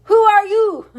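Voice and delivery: high, loud demanding question. Acted. Bandit leader demanding who the stranger is, loud. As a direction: bark it at the stranger.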